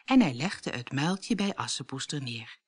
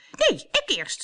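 shout; 11 semitones higher than usual. A narrator's reading. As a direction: shove ahead with a yell. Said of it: "schreeuwde Truida".

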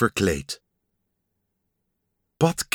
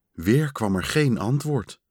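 ordinary delivery, nothing marked

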